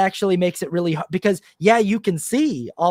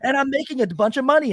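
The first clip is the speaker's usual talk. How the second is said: mock-excited landlord boast, pitched up; boastful landlord; 8 semitones higher than usual. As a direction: play up a TikTok braggart showing off a hack. Acting out boastful landlords on TikTok.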